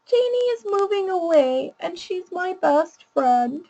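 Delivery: put-on a sad kid's news pitched high; high child voice. Shown as a demonstration. Educator voicing the child's sad news in a high voice. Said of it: "I'll use a really high voice to read the child's problem".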